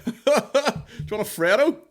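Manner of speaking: mock ad voice. Parodying a chocolate advert with a laugh.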